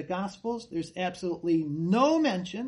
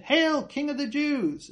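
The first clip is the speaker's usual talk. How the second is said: loud, higher mocking salute; mock hail; 7 semitones higher, 3 dB louder than usual. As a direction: hail him as a sneering soldier. Soldiers mock Jesus.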